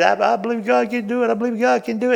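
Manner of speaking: high, hopeful pleading, hammed up; mock pleading prayer. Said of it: "Have you ever prayed".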